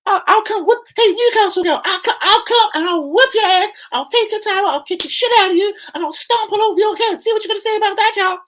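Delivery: very high squeaky threatening rant; squeaky trash-talk voice. Acted. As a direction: rant in a squeaky put-on falsetto. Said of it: "gonna put my squeaky voice".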